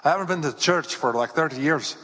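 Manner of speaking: imitating an Irishwoman's accent; Irish accent. Irish visitor telling the pastor about church, Irish accent. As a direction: put on a light-hearted Irish accent. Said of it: "that's a pretty good accent".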